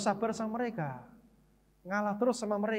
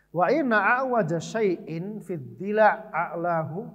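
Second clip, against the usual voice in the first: slow, measured Arabic recitation, louder than his talk; Arabic hadith recitation; 5 dB louder, slower than usual. Shown as a demonstration. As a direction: recite the hadith solemnly and deliberately.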